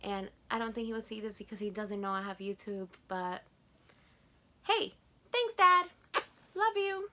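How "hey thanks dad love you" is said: high, bright call of thanks to Dad; sweet high thanks. Real, not acted. Daughter calling thanks to her dad, high and sweet. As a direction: sing out a loving thank-you.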